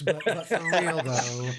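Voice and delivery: chuckling through the words; laughing. Real, not acted. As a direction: laugh while saying it. Joking about Stunes, laughing.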